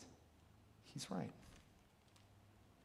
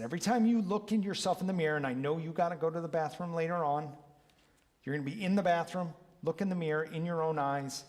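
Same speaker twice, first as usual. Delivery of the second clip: higher, louder, fast instructions; eager coaching; 4 semitones higher, 6 dB louder, faster than usual. Retold or imitated. Coach urging a client into mirror work, eager. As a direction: pump her up about the mirror.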